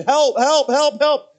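loud, high cries for help; screaming for help. Retold or imitated. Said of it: "And, and I was just screaming".

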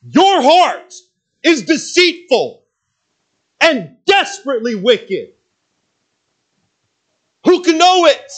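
very high, loud prophet cry; high, loud yelled warning; Jeremiah yelling. Retold or imitated. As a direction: shout it at the people like Jeremiah. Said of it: "he basically said".

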